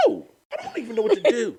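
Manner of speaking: high, loud and flustered; panicked. Retold or imitated. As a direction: sound overwhelmed. Panicked answer.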